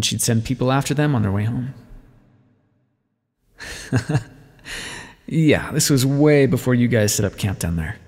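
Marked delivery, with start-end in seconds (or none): 3.6-4.2 brief breathy laugh; small, airy laugh; short chuckle. Acted. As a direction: chuckle lightly under the breath. Amused aside to the listener.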